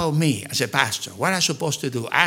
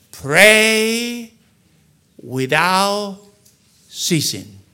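each word held and set apart; emphatic recitation; 3 semitones higher, much slower than usual. Real, not acted. Preacher quoting the Bible to the church, drawn-out emphatic recitation.

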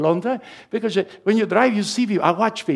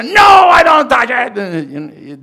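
loud, slow, stretched-out retort; a husband yelling back at his wife in mock defense; mock shout back; 6 semitones higher, 9 dB louder, much slower than usual. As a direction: bellow it back defensively, slowly. Imagined snapping back at wife.

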